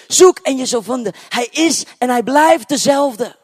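high, loud, ringing pulpit voice; preaching at full force. Acted. Preacher proclaiming Jesus' words to the congregation, loud and high. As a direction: proclaim it to the whole hall.

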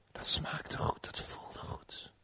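slow, hushed narration; whispered savouring. A narrator's reading. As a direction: whisper it, relishing the taste. Vrouw proeft de vrucht. (langzaam genietend gefluisterd)

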